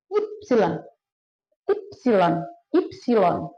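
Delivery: Ypsilon said "ip-si-lon", falling at the end; German Y. Shown as a demonstration. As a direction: pronounce Ypsilon slowly for learners as "ipsilon". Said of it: "dalam bahasa Jerman ngomongnya". (Ypsilon dibaca "ipsilon" ala Jerman)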